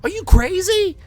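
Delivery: very high, loud shrieking mom; mother shrieks at her son, very high and loud; hysterical mother. Retold or imitated. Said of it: "She's like".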